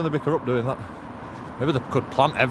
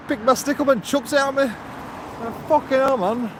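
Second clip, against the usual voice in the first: much higher, indignant outburst; outraged; 9 semitones higher than usual. Retold or imitated. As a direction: exclaim in disbelief. Outraged retelling.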